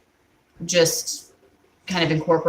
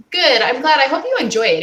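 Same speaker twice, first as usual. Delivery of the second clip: excited; 8 semitones higher, 6 dB louder than usual. Real, not acted. Happy reply to viewer's comment.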